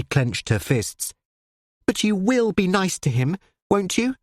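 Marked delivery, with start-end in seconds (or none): 1.9-4.2 pleading. A narrator's reading. Daughter pleads.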